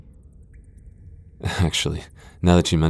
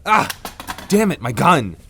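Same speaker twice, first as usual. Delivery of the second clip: startled outburst; 9 semitones higher than usual. Acted. Vampire yelps as the hunter knocks her gun away. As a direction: caught off guard, losing the gun.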